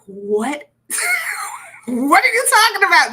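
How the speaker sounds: loud, high disbelieving "What?"; the husband blindsided, shouting in confusion; confused outburst. Acted. Husband blindsided by his wife's attack, loud confused shout. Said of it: "he's like".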